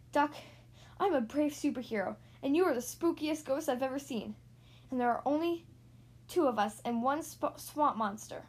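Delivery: ordinary delivery, nothing marked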